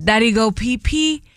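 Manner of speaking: drunk dad imitating a baby. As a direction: coo it like a toddler. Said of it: "in a baby voice was going".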